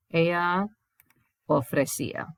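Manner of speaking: normal talking voice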